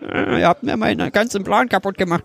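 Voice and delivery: high, whiny brat Anakin; sulky child voice. Retold or imitated. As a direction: whine it like a spoiled kid. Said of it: "bockiges Kind dieser Anakin".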